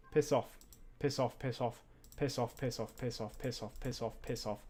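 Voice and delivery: soft, low, repeated cursing; muttered dismissal. Real, not acted. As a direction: mutter it over and over under your breath. Muttering at unwanted character.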